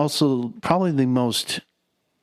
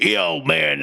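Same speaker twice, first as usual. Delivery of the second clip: mock-angry burly guy, pitched up; a touch of burly aggression; Raphael; 4 semitones higher than usual. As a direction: imitate a tough guy's annoyance. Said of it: "he was just angry".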